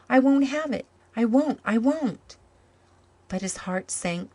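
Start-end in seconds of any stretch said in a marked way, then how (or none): 0.1-2.4 protesting. A narrator's reading. Boy protesting losing his friend.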